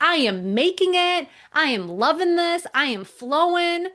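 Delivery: high, sing-song giddy boasting. Retold or imitated. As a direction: imitate a client floating on success. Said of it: "he was so giddy and so happy".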